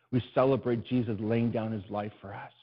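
ordinary talk, nothing marked

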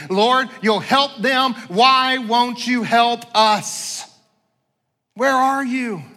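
loud, high, drawn-out appeal to heaven; pleading prayer. Acted. Church praying for help unanswered, anguished crying out. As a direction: cry out slowly as if God is not answering.

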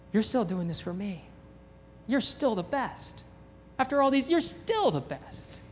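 soft, rising with wonder; tender awe. Acted. Pastor to Jesus while cheering baptisms, soft with rising wonder. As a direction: marvel quietly at grace.

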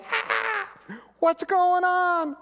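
high, drawn-out, bewildered cry. Retold or imitated. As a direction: voice jumping high in confusion. Believers reacting to world upside down.

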